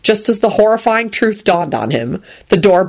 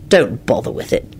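cross; 6 semitones lower than usual. A narrator's reading. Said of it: "he told her crossly".

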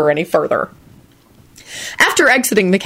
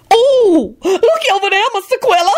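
excited shriek. A narrator's reading. Lucretia calling to Quentin, much higher excited shriek. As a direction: shriek it up high. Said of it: "Lucretia shrieked suddenly".

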